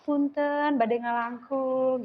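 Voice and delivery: gentle Sundanese politeness, lightly imitated; soft Sundanese. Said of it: "Kalau Sunda kan lemah lembut juga ya".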